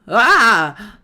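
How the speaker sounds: loud sudden yelp; startled yelp; startled cry. Acted. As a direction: cry out in fright. Something hits back.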